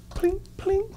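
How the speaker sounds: tiny high-pitched coin plinks; soft, very high "pling" of dropping change; imitated coin clink. Officer feeding change into horse ride, imitated clinks.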